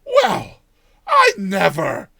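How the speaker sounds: high, loud, beaming surprise; delighted cry. Acted. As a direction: cry it out full of smiles. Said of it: "she cried, full of smiles".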